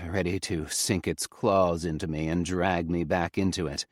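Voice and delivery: ordinary delivery, nothing marked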